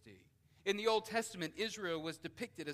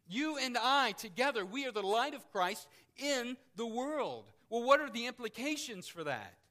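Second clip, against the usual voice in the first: louder, higher, ringing out each phrase; preaching at full force; 7 semitones higher, 11 dB louder than usual. Acted. Preacher reading scripture to the congregation, forceful preaching. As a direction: proclaim it to the whole room.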